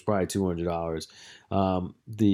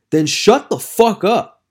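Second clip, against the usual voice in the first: loud, high, drawn-out retort; acted-out angry comeback; exasperated shutdown; 10 semitones higher, 8 dB louder, slower than usual. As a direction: land it slowly and forcefully. Retold punchline of confrontation.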